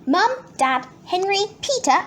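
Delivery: plain reading voice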